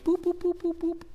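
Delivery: very high, soft beeping noises; imitating kiosk beeps. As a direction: chirp the kiosk button beeps in a tiny high voice. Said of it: "I'm like".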